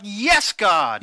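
loud burst of praise; loud preacher's cry to God; exultant shout. Acted. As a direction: shout it out as revelation strikes. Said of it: "you shout".

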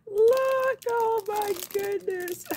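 high, loud squeal of delight; overjoyed, almost shrieking. Real, not acted. Opening her friend's present, shrieking with delight.

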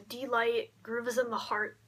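usual speaking voice

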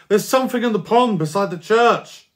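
loud, high, breathless news blurted out; excited shout. Acted. Boy bursting into the house to tell his parents, excited shout. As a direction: burst in yelling the discovery.

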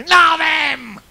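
huge, high shouted refusal; punchline shout. Acted. Mute shouts the punchline at the barman. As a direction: blast the punchline.

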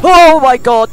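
sudden high frightened shriek; terrified scream. Real, not acted. Jump scare in horror game.

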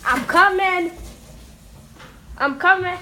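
shouted warning; loud calling out. Real, not acted. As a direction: shout it to the hiders. Seeker calling out.

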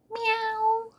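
high, sustained cat meow; long, level high-pitched mew. A narrator's reading. Narrator voices the cat's meow.

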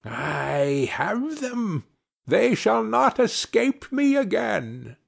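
slow, sneering witch voice; mocking witch. A narrator's reading. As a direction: gloat slowly like a witch. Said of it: "said mockingly".

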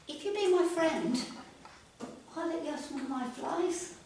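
hopeful frog. Acted. Freddy offers flies.

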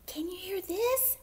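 high, airy little mouse voice; small mumbling mouse. A narrator's reading. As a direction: test it in a tiny high whisper. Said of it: "Mouse mumbled into his pillow".

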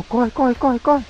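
high urgent run run; panicked urging. Real, not acted. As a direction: hurry them in a panic. Fleeing monster in game.

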